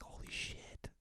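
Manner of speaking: whispered disbelief; whispered shock at the news; stunned whisper. Retold or imitated. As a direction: gasp it under your breath. Stunned at shared birthday.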